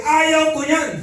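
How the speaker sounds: retold shout. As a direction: yell the refusal to shake him off. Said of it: "Sumigaw na ako eh". (pasigaw na pagtanggi)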